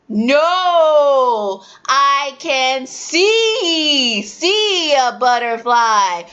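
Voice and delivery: teacher correcting a student; slow correction. Shown as a demonstration. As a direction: hammer home the word see.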